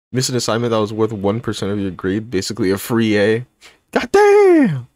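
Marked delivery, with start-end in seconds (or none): 3.9-4.9 God damn squeaked much higher and louder; overplayed gasp of disbelief at chat; exaggerated shock. Real, not acted. Streamer reacts to a chatter's missed assignment with squeaky shock.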